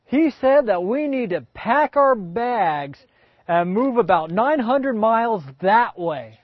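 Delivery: slow, high, loud explaining; eager Abraham. Acted. Abraham telling Sarah to pack, slow and eager. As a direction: spell out the grand plan eagerly. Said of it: "Abraham goes".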